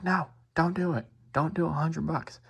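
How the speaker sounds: high-pitched mock country lady; impersonated country accent. Lady in the car objecting to the offer, mock country accent. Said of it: "impersonation of her accent".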